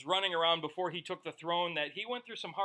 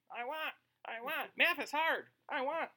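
high, mechanical pull-string doll whine; pull-string talking doll; 8 semitones higher than usual. Retold or imitated. Preacher mocks whiny prayers as a pull-string doll. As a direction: play a wind-up doll whining on repeat. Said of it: "Like that little doll, you pull the string".

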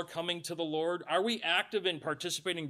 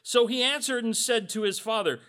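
ordinary delivery

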